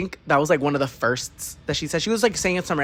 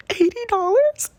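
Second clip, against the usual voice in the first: squeaky, much higher, louder rising question; shocked disbelief; 4 dB louder than usual. Retold or imitated. Hearing the reading costs eighty dollars, high shocked squeal. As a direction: shriek the price back in disbelief.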